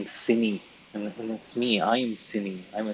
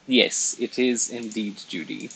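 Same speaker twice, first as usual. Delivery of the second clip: ordinary delivery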